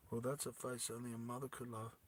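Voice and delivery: very soft, low murmur; hushed aside. Acted. Vas looking at her wrecked face, hushed remark. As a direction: say it under your breath.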